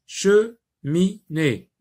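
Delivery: "cheminée" as che, mi, née; slow "cheminée" in three separate beats; split into syllables. Shown as a demonstration. Teacher doing the exercise, "cheminée" broken apart. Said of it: "Sépare les mots en syllabes".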